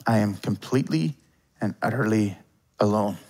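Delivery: quiet despair. Retold or imitated. Preacher voicing David's forsaken cry, hushed and forlorn. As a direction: let it fall away to nothing, alone.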